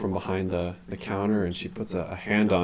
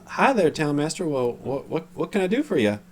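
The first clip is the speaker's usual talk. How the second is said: high, lilting shop greeting; higher-pitched, lively hello; shopkeeper greeting; 8 semitones higher, 3 dB louder than usual. Acted. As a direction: greet a visitor in a higher character voice. GM voices Halia greeting town master.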